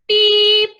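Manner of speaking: high, loud toy horn beep; one held, high-pitched beep. A narrator's reading. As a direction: beep like a cheerful little truck horn. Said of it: "said the friendly little blue truck".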